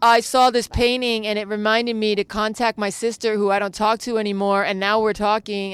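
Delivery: loud, insistent testimonial; gushing fan. Retold or imitated. Viewer telling artist a painting reunited sisters, gushing loudly. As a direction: pour it out loudly, imitating a moved admirer. Acts out a moved viewer's confession.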